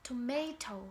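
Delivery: tomato with a clear second T; tomato with both T sounds fully pronounced; British T. Shown as a demonstration. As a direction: say tomato as "to-may-toh". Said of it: "British say".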